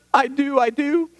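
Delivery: put-on high, pleading little-girl voice; a small child's voice, sobbing out a yes; imitating small child. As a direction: mimic a tearful toddler's eager yes. Daughter answers, retold.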